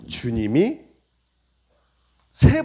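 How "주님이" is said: ordinary talk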